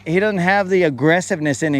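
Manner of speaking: ordinary delivery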